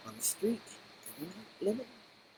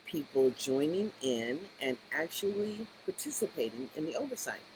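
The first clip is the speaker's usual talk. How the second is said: ordinary delivery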